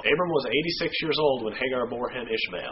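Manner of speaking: formal, measured reading of the verse; scripture reading. Acted. Reading the verse to the listeners, in a formal reading voice. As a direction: read it out as the Bible text.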